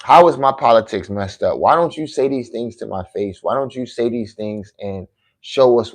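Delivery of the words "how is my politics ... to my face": usual speaking voice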